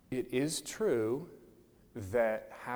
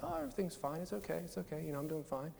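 higher, much softer brush-off; pretending it's fine; 4 semitones higher, 8 dB quieter, faster than usual. Hurting person answering someone praying for them, pretending it's fine. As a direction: wave away sympathy in a small, high voice. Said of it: "don't ever want to admit that it hurts".

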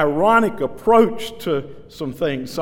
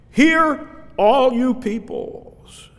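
much higher and louder, slowed; calling out; 9 semitones higher, 4 dB louder, much slower than usual. Acted. Micaiah calling all the people to hear, proclaiming. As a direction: proclaim it to the crowd.

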